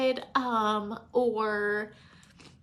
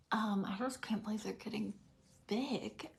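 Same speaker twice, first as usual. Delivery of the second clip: choked-up whisper; 7 dB quieter than usual. Real, not acted. Mom tearing up over kids growing.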